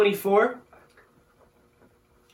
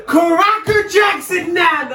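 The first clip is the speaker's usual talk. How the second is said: high, loud, drawn-out British nonsense yell; pretend British shout; 13 semitones higher, 8 dB louder, slower than usual. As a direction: bellow it in silly mock-British. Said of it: "pretend to be British and just start saying".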